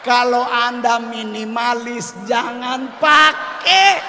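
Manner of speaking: high, loud and slow on jangan pakai; comic shouted warning. Acted. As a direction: bellow the warning at the crowd. (penonton diperingatkan sambil berteriak tinggi dan lambat)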